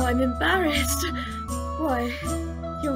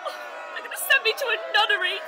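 high-pitched lament; scandalised, tearful outcry; distraught wailing; 6 semitones higher, faster than usual. Acted. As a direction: bewail your ruin theatrically. Woman laments dancing with an impostor.

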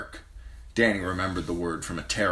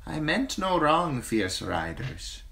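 slave woman's voice; 10 semitones higher, slower than usual. Acted. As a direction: offer help meekly.

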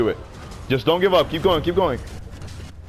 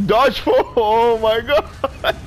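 laughing; 8 semitones higher, much slower than usual. Real, not acted. Laughing at friend's fall.

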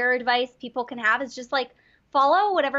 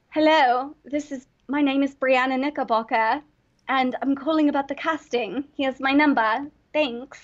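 acted British voicemail greeting; put-on British accent. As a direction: leave a message in an imitated British accent. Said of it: "And I kept up the accent".

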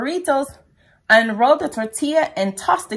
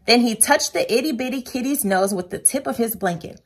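ordinary delivery, nothing marked

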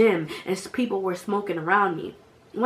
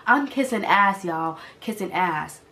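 ordinary delivery

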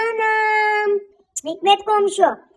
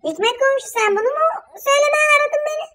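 higher, rushing, near tears with outrage; shrill indignant outburst; 7 semitones higher, faster than usual. Acted. Woman on the phone snapping at her neighbour, indignant and tearful. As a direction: scold the neighbour fast and high, almost crying.

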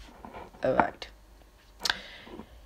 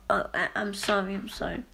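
normal talking voice

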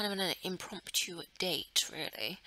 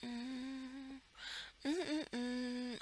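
short hummed mm-mm-mm; humming mm-mm-mm; 4 semitones higher, 6 dB quieter than usual. Real, not acted. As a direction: hum the mm-mm-mm idly. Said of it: "I don't know why I'm saying mm-mm-mm".

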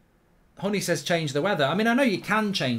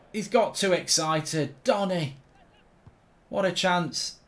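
ordinary delivery

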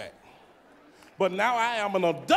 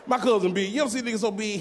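normal talking voice

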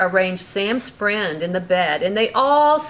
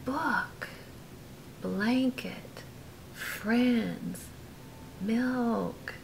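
whispered; 10 dB quieter, much slower than usual. A narrator's reading. Repeating the list in a whisper.

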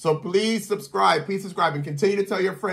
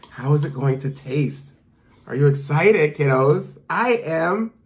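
ordinary delivery

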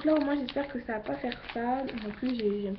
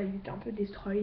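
usual speaking voice

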